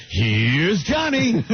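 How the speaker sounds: slow, stretched delivery; slow drawn-out Here's Johnny. Retold or imitated. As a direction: drag it out slowly. Another take.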